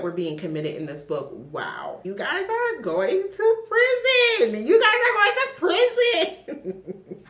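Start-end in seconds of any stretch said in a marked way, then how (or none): 2.0-4.7 much higher, slow, mock-stern sing-song; mock scolding. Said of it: "scolding the book's criminals". 4.7-7.2 even higher, laughing through it; laughing mock scold. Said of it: "repeats laughing".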